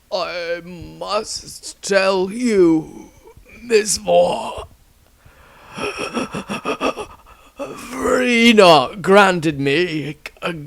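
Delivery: high, very slow and strained, last breaths; dying man. Acted. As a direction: force out each word as life fades. Dying Tristram speaks his last.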